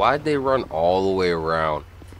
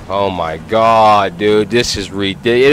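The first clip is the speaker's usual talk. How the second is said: loud, startled oh my God; shocked outburst; 4 semitones higher, 8 dB louder than usual. Real, not acted. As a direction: blurt it out in disbelief. Sudden shock at returning truck.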